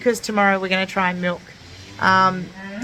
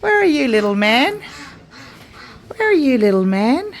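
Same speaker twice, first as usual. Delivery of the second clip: calling sweetly; 4 semitones higher than usual. Real, not acted. Calling out to calf.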